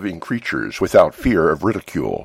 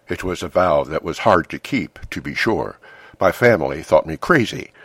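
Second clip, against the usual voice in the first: usual speaking voice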